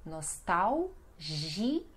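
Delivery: "nostalgia" very slowly, nos-TAU... ZHI; L sounded as U. Shown as a demonstration. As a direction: split "nostalgia", L as U, soft zh for G. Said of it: "então som de U".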